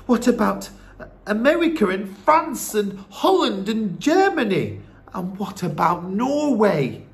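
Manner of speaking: put-on high, urgent boy voice; boy listing places insistently; protesting young grandson.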